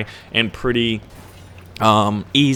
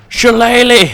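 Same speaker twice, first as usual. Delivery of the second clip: big playful shout, much higher; mock battle cry; 13 semitones higher, 9 dB louder than usual. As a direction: belt it out like a war cry. Exclaims the missile's odd name.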